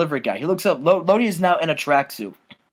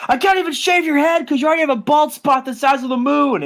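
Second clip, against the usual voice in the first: high-pitched mock taunt; hammed-up high heckling; Raven; 9 semitones higher than usual. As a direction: jeer it in a put-on exasperated voice. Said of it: "and he says".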